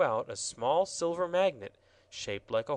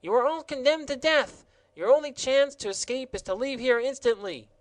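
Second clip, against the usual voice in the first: much higher, louder furious gardener; angry shout; 12 semitones higher, 7 dB louder than usual. Acted. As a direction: bellow the death sentence. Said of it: "shouted angrily".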